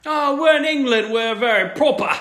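very high, loud mock posh English voice; posh Brit impression. Co-host joking about proper English leaguemates, mock posh voice. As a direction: play a prim, proper Englishman. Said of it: "mocking the Brits".

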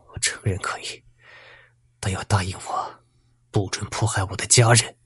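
soft, broken, near-whispered plea; weeping plea. A narrator's reading. Beaten teacher begging the bandit to spare his family, tearful hush. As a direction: beg through tears, barely voiced. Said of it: "朱老师哭泣地说".

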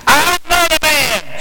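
high, drawn-out angry denial; slow, high-pitched oath of denial; pretty angry. Acted. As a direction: swear it indignantly, pitch way up. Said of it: "Sounds pretty angry to me".

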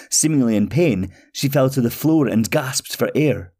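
usual speaking voice

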